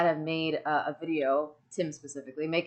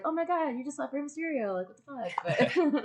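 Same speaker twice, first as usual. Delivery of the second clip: put-on childlike disbelief; 8 semitones higher, 5 dB quieter than usual. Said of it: "eight-year-old [name] was like".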